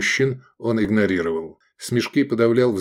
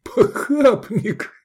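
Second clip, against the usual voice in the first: high, slow, laugh-choked word; gurgling, exhausted laughter; 13 semitones higher, much slower than usual. A narrator's reading. Friend teasing the tunic wearer, gurgling with laughter. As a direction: burble it out, spent from laughing. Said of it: "пробулькал Искандер в изнеможении".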